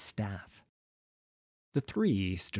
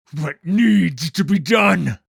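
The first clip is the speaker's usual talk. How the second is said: loud, slow, bitter reply; angry and grieving; 8 semitones higher, 14 dB louder, slower than usual. Acted. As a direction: grind it out in anger and grief. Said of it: "his face contorted by anger and grief".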